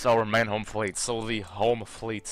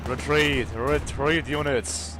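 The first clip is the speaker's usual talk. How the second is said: frantic retreat order; 4 semitones higher, 4 dB louder, much slower than usual. Pretend. Ordering French units back.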